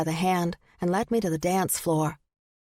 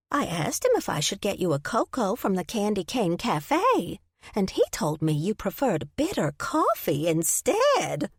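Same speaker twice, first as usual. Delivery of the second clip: put-on old lady voice.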